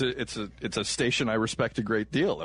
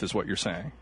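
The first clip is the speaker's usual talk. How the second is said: ordinary delivery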